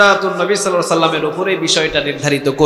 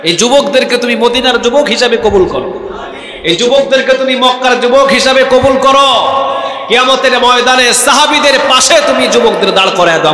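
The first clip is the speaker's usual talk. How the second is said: loud, raised prayer call; shouted supplication; fervent prayer; 7 semitones higher, 5 dB louder than usual. Acted. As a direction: plead aloud to God for the young men.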